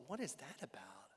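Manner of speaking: hushed, voiceless aside; whispered thought. Retold or imitated. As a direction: whisper it like a baffled private thought. Retells his private baffled thought.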